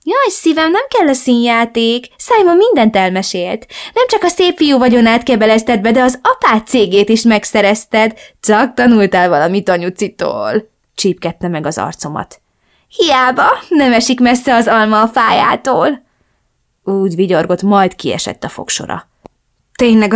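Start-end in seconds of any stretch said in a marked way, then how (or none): 0.0-10.7 higher, louder mocking mother voice; gúnyos anya. 12.9-16.0 higher, louder mocking mother voice; gúnyos anya.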